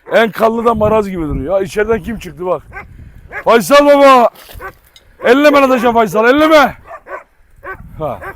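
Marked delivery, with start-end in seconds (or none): 3.4-6.7 louder, higher "elleme" calls; firm loud telling-off; loud warning. Real, not acted. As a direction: shout at the dogs to leave him alone. Warning dogs off Faysal.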